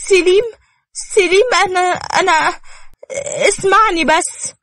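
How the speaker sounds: high, frantic pleading; desperate pleading. Acted. Nour begs Selim to hear her, high and frantic. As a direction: beg him to just listen.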